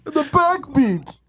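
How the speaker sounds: high, slow, crying-laugh villain voice; mock Bane. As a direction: wail it like a hammed-up villain. Parodying Bane line.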